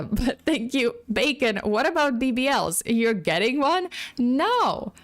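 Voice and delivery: ordinary talk, nothing marked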